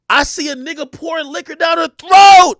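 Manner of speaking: high, loud outraged telling; shocked shouting. Retold or imitated. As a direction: shout it like you can't believe your eyes. Shocked at what he saw.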